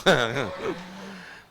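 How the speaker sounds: a real burst of laughter; big rising laugh at the joke; laughing out loud. Real, not acted.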